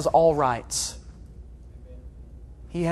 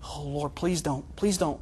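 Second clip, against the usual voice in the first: hushed pleading; 3 semitones lower, 8 dB quieter than usual. Acted. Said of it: "I find myself just".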